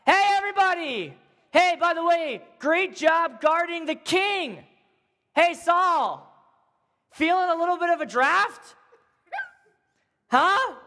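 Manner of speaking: loud, high mocking call to Saul; loud, high teasing shout. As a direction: taunt the king from across the hill. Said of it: "David shouted out".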